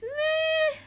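high-pitched, dismissive meh; exaggerated meh. Shown as a demonstration. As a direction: squeal an unimpressed meh. Acts out meh rating.

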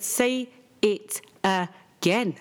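spaced-out, drawn-out order from a fed-up parent; stern demand. Retold or imitated. Mum demands her crying daughter repeat herself, slow and stern. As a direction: say it very slowly, word by word.

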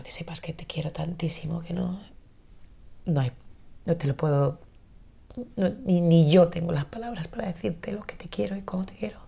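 hushed, loving whisper; tender whisper. Retold or imitated. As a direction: whisper it as if holding your son close. Tender words to her son.

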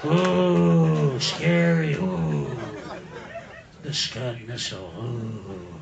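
a low, mocking ooh at the Scud; sarcastic spooky ooh; mock fear; mock scared ooh.

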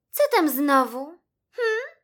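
high, loud, miffed little voice; not very nice. A narrator's reading. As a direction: answer curtly as an offended mouse. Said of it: "odparła niezbyt miłym głosikiem Iksi". (urażony, niezbyt miły głosik)

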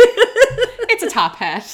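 slow, high, laughing; straining to keep a straight face; trying not to laugh. Real, not acted. Laughing at innuendo.

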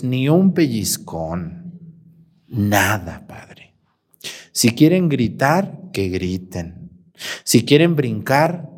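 low, slow, hushed mother, half whispered; lax mother. Retold or imitated. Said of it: "Me dijo".

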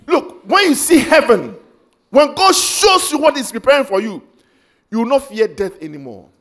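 ordinary delivery

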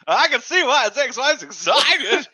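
high, slow character quip; put-on rookie voice. Rookie joking about the conductor's wife, put-on high voice. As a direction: draw the joke out in a high character voice.

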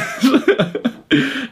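real squealing laugh; delighted high cackle; high burst of laughter. Real, not acted. Laughing at own joke.

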